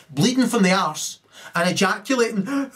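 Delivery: breaking into high laughter; cracking up mid-rant; laughing. Real, not acted. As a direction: laugh through the words.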